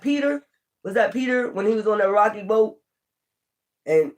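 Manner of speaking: ordinary talk, nothing marked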